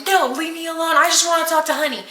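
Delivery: high, hurried imitated pleading; distraught protest. Imitating girlfriend shutting her mom out, distraught and high. Retold refusal to let mom in.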